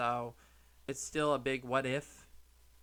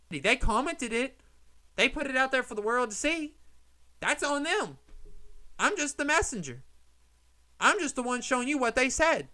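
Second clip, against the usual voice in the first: higher and louder, fired up and defiant; heated; 8 semitones higher, 3 dB louder than usual. Real, not acted. Vlogger defending showing the comments, heated and defiant. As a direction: throw it back at the critics. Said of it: "I'm just getting so heated about this".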